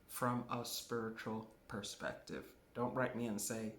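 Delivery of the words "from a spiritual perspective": lower, quieter repeat. Real, not acted. Repeats the point, dropping down.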